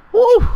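high, loud "woo" of joy; celebratory whoop. Real, not acted. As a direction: let out a piercing victory whoop. Celebrating the win.

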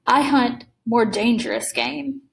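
slow, measured menace. Acted. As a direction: let each word land slowly. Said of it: "he said in the same slow tone".

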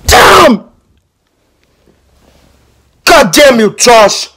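high, full-force cursing; loud, high-pitched outburst; furious yelling. Acted. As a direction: scream out curses at an opponent. Acted fight with Josh.

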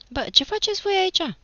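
put-on high, loud call to the boys; shouted challenge. A narrator's reading. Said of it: "strigă el".

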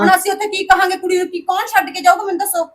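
high, loud demanding questions; angry shouting. Acted. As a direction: demand answers at full voice. Sister shouting in family quarrel. (ਗੁੱਸੇ ਵਿੱਚ ਉੱਚੀ ਸਵਾਲ)

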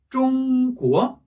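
"쫑꾸어" with high held "쫑", then rising "꾸어"; first-second tone. Shown as a demonstration. Teacher joining the syllables, "쫑꾸어" slow, level then rising. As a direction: slowly hold "쫑" high, drop and lift "꾸어". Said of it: "일 성, 이 성이에요".

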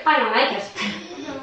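ordinary talk, nothing marked

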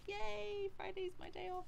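high, bright "Yay!" for the day off; excited cheer. Real, not acted. Cheering to viewers about the Friday off, high and excited. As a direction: squeal with delight at the day off.

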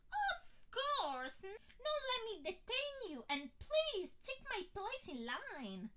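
delighted to oblige, voice soaring; eager admirer. A narrator's reading. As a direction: fan offering his place, high and eager. Man eagerly gives way.

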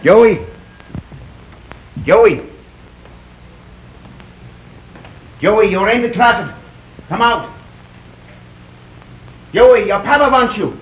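loud call through the house. Acted. Father calling his son to come out of the closet, loud call. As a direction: call the boy out of hiding.

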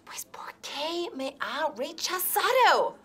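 whispered, baffled aside; puzzled whisper. Acted. Jilted teacher wonders aloud, whispering. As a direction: whisper it to yourself, confused.

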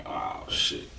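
breathy whispered aside, no voice; whispered oh shit. Retold or imitated. To himself after being insulted, a whispered curse. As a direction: let it slip out under your breath.

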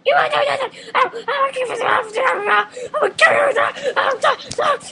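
very high, loud squealing princess. Acted. Vlogger doing the princess impression, squealed Peach voice. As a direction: screech it in a piercing falsetto. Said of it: "Um, Beach Boys".

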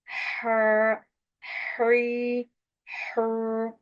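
"Her, hurry, hur" drawn out with breathy throat h; long, level "Her, hurry, hur" from mid-throat; lengthened correct reading. Shown as a demonstration. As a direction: hold each thin vowel long in "Her, hurry, hur". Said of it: "Doğru telaffuz".

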